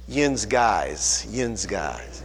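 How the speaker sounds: lightly voiced Pittsburgh accent, "Yins" with a nasal vowel; a casual hint of Pittsburghese. Shown as a demonstration. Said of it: "if I did, I'd say".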